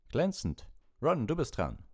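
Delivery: high, bright-pitched praise and summons; praise, then call the next one up. A narrator's reading. Lupin praises Dean and calls Ron up, calling out.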